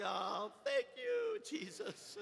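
very high, drawn-out strained thanks, fading off; comic squeezed gasp of relief; mock relief. Worn-out helper thanks heaven for the car, mock relief.

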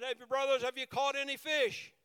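Jesus calling to the fishermen from shore. Retold or imitated. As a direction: holler out to the boat. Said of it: "hollered out".

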